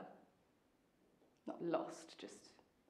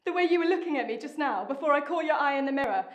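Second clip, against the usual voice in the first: raised, higher voice; accusing; 4 semitones higher, 7 dB louder than usual. Acted. Confronting partner over a look in the mirror, accusing. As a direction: confront them with a louder accusation.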